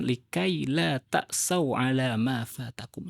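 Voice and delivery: slow Arabic recitation; Quranic recitation. Shown as a demonstration. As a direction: draw out each word like tilawah. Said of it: "Dalam Al-Quran disebutkan". (membaca ayat Al-Quran dengan pelan)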